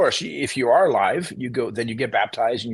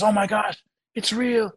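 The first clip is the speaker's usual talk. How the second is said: high astonished oh my gosh; amazed realization; 9 semitones higher, slower than usual. Retold or imitated. Dying uncle realizing his faith is real, astonished and high. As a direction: let the wonder break through up high. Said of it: "he goes".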